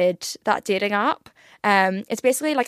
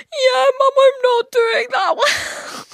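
hammed-up wailing cry, much higher and louder; mock crying; 4 dB louder, much slower than usual. Hungover daughter wailing to her mum, mock crying. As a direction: sob it out like a hammed-up breakdown. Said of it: "And I'd be like".

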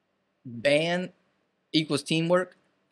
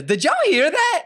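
high, slow, loud and laughing; high laughing mock voice; 7 dB louder than usual. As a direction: squeal the quote through laughter. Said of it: "and Dylan says".

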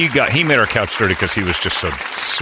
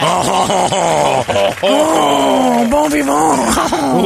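high, drawn-out French fop cry; Frenchman laugh; 5 dB louder, much slower than usual. Retold or imitated. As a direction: swoon like a pompous Frenchman. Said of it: "[name]'s Frenchman laugh".

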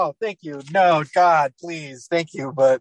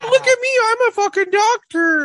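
very high, loud squeaky mock voice; mocking boast; 7 dB louder than usual. Host mocking someone who says "I'm a doctor", shrieky high mocking boast. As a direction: jeer it in a loud falsetto.

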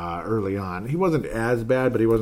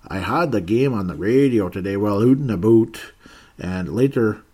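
louder mock-Canadian read-out; Canadian accent; 5 dB louder than usual. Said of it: "he's got a Canadian accent".

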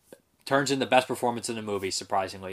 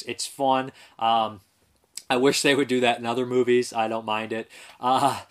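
usual speaking voice

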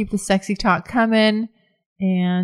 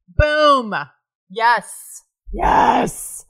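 excited cheer; 3 semitones higher, much slower than usual. Real, not acted. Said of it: "I'm so excited".

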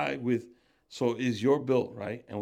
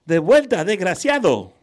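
much higher, louder dubbed tough-guy lines in Spanish; squeaky Spanish dub; 7 semitones higher, 11 dB louder than usual. Shown as a demonstration. Host demonstrating the squeaky dub voice to his guest. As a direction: overact it like a Spanish film dub. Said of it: "make it a squeaky voice".

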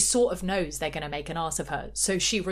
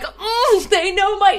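high, loud hammed-up delight; giddy; 14 semitones higher, 11 dB louder, slower than usual. Co-host mimics housewife hearing her song, giddy. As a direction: squeal it in mock rapture. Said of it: "She's so giddy".

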